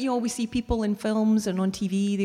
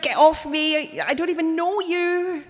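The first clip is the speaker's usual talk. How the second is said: wanting to shout; 8 semitones higher than usual. Retold or imitated. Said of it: "And I just want to shout".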